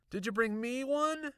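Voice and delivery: high, loud teasing question; joking boy. A narrator's reading. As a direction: tease her in a kid's voice. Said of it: "Aaron laughed".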